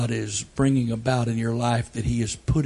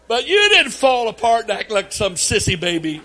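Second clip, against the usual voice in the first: ordinary delivery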